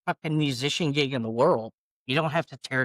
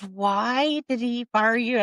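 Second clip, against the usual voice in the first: high, drawn-out acted question; baffled disbelief; 9 semitones higher, slower than usual. Colleague asks why singer got fired, incredulous. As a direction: stretch it out in astonishment.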